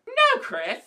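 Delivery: very high-pitched mock outrage; indignant denial. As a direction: squeal the denial in a huff. Indignant comeback in comic bickering.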